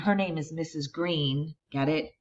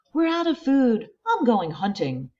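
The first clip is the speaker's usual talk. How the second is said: pilgrim man; 8 semitones higher, 5 dB louder than usual. Acted. Pilgrim voiced in story.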